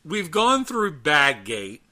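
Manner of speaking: ordinary talk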